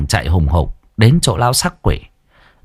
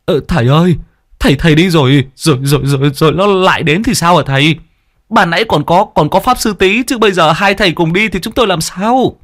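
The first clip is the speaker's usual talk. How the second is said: much higher, fretful stammering plea; anxious pleading girl; 9 semitones higher, faster than usual. A narrator's reading. Frightened villager begging the exorcists to stay, anxious pleading. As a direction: beg them not to leave.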